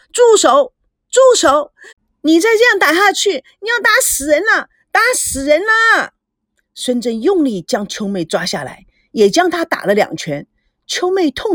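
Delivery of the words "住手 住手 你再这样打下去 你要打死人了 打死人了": high, urgent screams to stop; frantic shouting. A narrator's reading. Maid stopping husband beating wife, frantic shouting. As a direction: cry out in panic, pitch climbing. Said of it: "疯狂地大叫".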